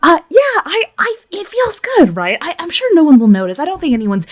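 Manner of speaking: put-on high, quick, fluttery girl voice; nervous Christine. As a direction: talk yourself into it a little too fast.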